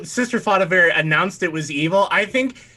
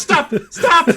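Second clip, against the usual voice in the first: higher, louder laughing cry of stop; laughing mock stop; 11 semitones higher, 6 dB louder than usual. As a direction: call it out through a laugh, half in jest. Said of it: "Sister Father goes".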